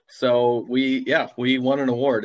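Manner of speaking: ordinary talk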